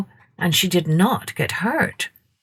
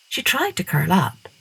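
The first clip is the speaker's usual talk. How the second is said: ordinary talk, nothing marked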